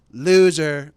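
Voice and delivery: very loud acted jeer; all-caps shout. Host repeating the tweet he wants, loud shout. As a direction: blast the insult out at full volume. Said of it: "all caps".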